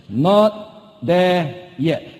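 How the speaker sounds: Not there yet, very slowly, each word separate; drawn-out motto; slow emphatic phrase. Shown as a demonstration. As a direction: let the words sink in. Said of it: "three words I want to share".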